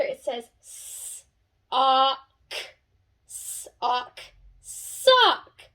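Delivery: slow segmented sounds, blended into sock; sounding out. Shown as a demonstration. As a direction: sound it out letter by letter, then blend. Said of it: "let's sound it all out together".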